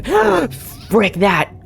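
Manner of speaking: sudden fright, high gasp; startled gasp. Real, not acted. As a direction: jump in fear at the monster. Monster suddenly appears.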